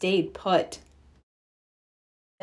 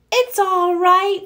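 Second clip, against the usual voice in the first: shouted back; 10 semitones higher, 5 dB louder than usual. Acted. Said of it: "Fox shouted back".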